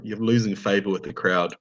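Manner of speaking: ordinary delivery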